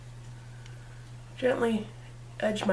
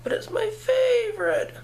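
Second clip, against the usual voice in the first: put-on much higher, louder childish whine; whiny plea; 12 semitones higher, 4 dB louder than usual. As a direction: plead like a kid begging for a treat. Whining about the price.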